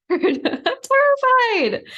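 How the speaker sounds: high, loud cry of being scared with a laugh; a loud, cracking release shout; shouting fear. Retold or imitated. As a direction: finally let it burst out, yelling through a laugh. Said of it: "why don't you shout how scared you are".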